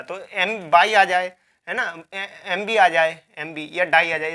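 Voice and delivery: usual speaking voice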